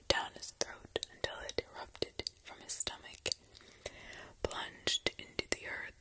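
ordinary delivery, nothing marked

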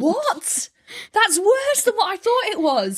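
laughing in disbelief. Real, not acted. Hearing about passing round crime photos, she laughs in horrified disbelief. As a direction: wail it out through laughter.

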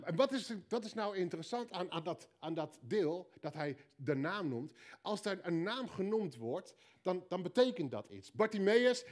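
ordinary talk, nothing marked

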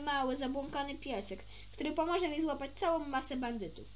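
plain reading voice

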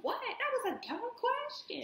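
high exaggerated mock voice; slow high teasing impression; mocking friend. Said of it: "You're like".

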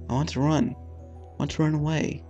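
normal talking voice

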